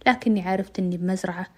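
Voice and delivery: usual speaking voice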